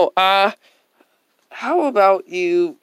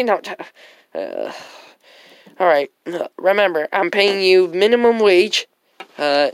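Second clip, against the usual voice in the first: ordinary delivery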